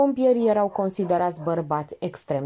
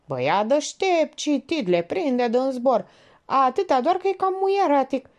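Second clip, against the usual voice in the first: lightly voiced gossiping Georgeta; 7 semitones higher than usual. A narrator's reading. Georgeta sizes up a man for Elena, gossiping. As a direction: weigh him up item by item, pitched high.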